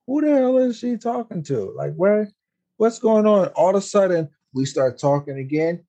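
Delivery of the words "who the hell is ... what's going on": high, startled what-the-hell; startled disbelief. Retold or imitated. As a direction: pitch jumps up in shocked confusion. Friends startled by a slap.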